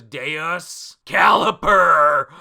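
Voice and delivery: a loud, slow, drawn-out battle cry in a put-on voice; attack name shout. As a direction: bellow the move like an anime finisher. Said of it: "And he goes".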